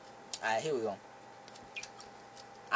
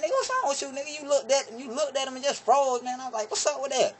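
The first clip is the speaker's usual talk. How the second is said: incredulous; 10 semitones higher than usual. Retold or imitated. Scolding cousin.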